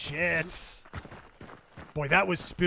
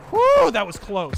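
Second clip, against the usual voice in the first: loud relieved whew; relieved exclaim; 5 semitones higher, 12 dB louder than usual. Real, not acted. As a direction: blow out relief after a close call. Close call in game.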